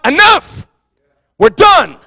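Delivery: high, loud declaration of refusal; emphatic shout. Acted. Preacher voicing parents who draw the line, emphatic shout. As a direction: shout it out as a final stand.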